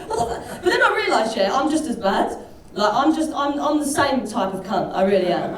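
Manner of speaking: usual speaking voice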